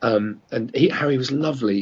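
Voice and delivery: ordinary talk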